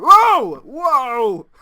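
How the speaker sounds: sudden high loud startled cry; startled yelp. Real, not acted. Squid spawns and scares the player, startled shout. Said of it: "scared the crap out of me".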